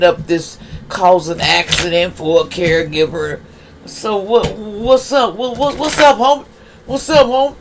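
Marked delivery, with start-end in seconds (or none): 4.6-7.5 playful high-pitched hello to the homie; mock street greeting. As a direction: hail them like a buddy on the corner.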